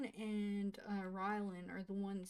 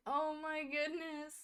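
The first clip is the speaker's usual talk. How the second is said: higher, amazed oh my goodness; stunned; 6 semitones higher, 3 dB louder than usual. Real, not acted. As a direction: let the shock ring. Still reacting in shock.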